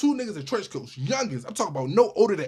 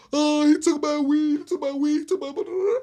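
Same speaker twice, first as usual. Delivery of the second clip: mock panicked roommate; 8 semitones higher, 4 dB louder than usual. Retelling the housemate crying over his stolen weed, mock higher wail. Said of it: "He talking about some".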